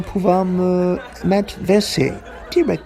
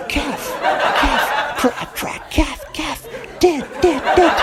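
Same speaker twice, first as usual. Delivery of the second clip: high eerie nightmare crow chant; 7 semitones higher, slower than usual. Retold or imitated. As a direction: croak it like a cursed crow. Crows carving words in nightmare.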